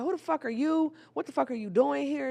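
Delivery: high, loud, angry demand; furious yell. Retold or imitated. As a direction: scream at the intruder. Confronting stranger in ex's house.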